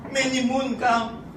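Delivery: high, slow, cheeky pidgin answer; lightly voiced sly wife; wife's pidgin reply. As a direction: toss the punchline back sweetly. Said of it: "And the wife said".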